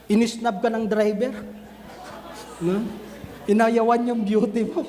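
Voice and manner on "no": usual speaking voice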